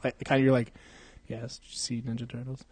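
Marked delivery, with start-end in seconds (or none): 1.3-2.6 quiet usual-volume imitation. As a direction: imitate a soft-spoken co-host. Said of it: "normally I think you talk, like, kinda".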